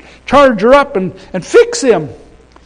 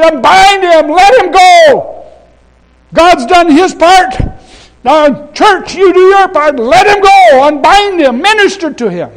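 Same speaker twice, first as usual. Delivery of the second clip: high, very loud preacher's shout; ringing, urgent pulpit cry; commanding exhortation; 10 semitones higher, 8 dB louder than usual. Acted. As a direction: call out orders to the whole church. Preacher voices command, exhorts church.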